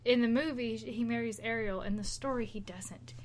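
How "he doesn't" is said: whisper. Real, not acted. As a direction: drop to a hushed whisper for the twist. Dramatic reveal of the twist.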